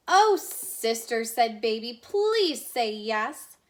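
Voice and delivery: slow, drawn-out begging; pleading. Acted. Younger sister begging her grouchy sister, slow and pleading. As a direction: coax her gently into yes.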